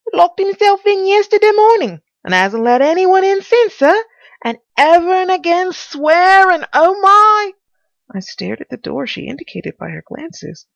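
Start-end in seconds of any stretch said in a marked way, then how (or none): 0.1-7.5 high, loud fussing housekeeper. Acted. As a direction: pitch it up as the flustered servant. Housekeeper voiced in story.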